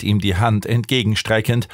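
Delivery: plain reading voice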